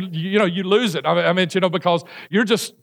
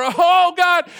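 high, loud hammed-up cry of Oh God; mock scream; 10 semitones higher, 4 dB louder than usual. Rider at eighty-five miles an hour, hammed-up scream. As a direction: wail it as you plunge. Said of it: "you're screaming like".

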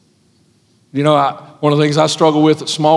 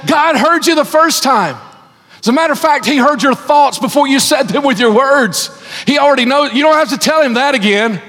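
preaching; 9 semitones higher than usual. Acted. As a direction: preach it out with full force. Preaching at full force.

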